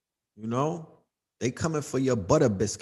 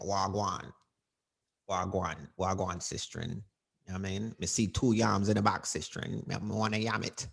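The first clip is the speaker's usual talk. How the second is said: lower, softer Jamaican patois, laid-back; mock Jamaican patois; 8 semitones lower, 8 dB quieter than usual.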